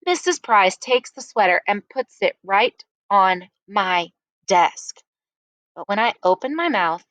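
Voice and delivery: usual speaking voice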